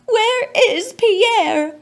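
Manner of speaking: loud, high, slow frantic cry; frantic mother. Acted. Mother frantically asking the lion where her son is, loud and high. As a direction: call out high and loud in panic. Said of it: "His mother asked".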